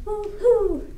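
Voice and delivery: high excited woo-hoo; happy dance whoop; cheerful woo-hoo. Real, not acted. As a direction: whoop it out with joy.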